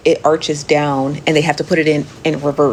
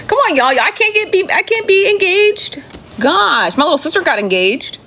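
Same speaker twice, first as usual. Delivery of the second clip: much higher, whining complaint to the chat; whiny protest; 12 semitones higher than usual. Real, not acted. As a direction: wail it like a jealous big sister. Whining about not being engaged.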